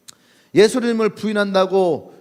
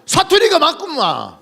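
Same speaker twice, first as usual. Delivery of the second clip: put-on Jeolla accent; 8 semitones higher, 5 dB louder, slower than usual. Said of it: "경상도 사람이 전라도 와 있는 거야".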